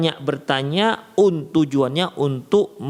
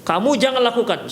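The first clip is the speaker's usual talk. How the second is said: sharp, higher stern "jangan"; firm prohibition; 7 semitones higher than usual. Shown as a demonstration. Teacher stopping a pupil, firm higher "jangan". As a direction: snap out the "jangan" like a strict teacher. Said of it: "melarangnya dengan intonasi yang kuat".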